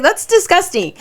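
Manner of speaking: high-pitched squeal of revulsion; mock disgust. Retelling her reaction to a crude joke, mock disgusted. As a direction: squeal it, playfully grossed out. Acted disgusted retort to coworker.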